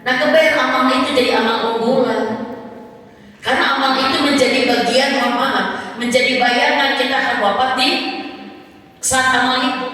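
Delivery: high, loud, fervent preaching; full-force preaching. Acted. Preacher rousing the women in the audience, loud and fervent. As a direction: preach it out to the congregation with fire.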